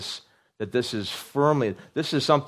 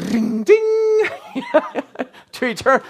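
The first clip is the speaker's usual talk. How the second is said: laughing; 6 dB louder, slower than usual. Acted. Playful exaggerated emphasis.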